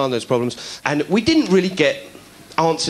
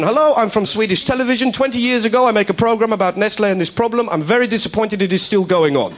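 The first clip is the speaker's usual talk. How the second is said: put-on Swedish accent; 6 semitones higher than usual. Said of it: "Another guy comes on".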